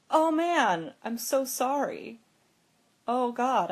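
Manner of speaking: imitating someone mortified at their mistake; apologetic. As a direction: gush a retold apology. Retold sister apologises after yelling.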